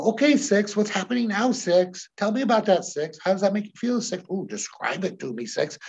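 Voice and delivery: put-on talking to a child. Shown as a demonstration. Hypnotist questioning a client's six-year-old self, high and coaxing. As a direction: coax the little one gently. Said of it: "That's the perfect hypnotic voice".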